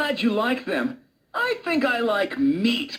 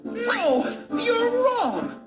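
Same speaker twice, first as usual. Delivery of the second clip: high, insistent denial; protesting; 9 semitones higher, much slower than usual. Acted. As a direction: deny the accusation in a raised pitch. Wolf protests accusation.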